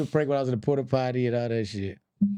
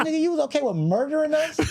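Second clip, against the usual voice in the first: incredulous; 13 semitones higher than usual. Retold or imitated. His outraged reply retold.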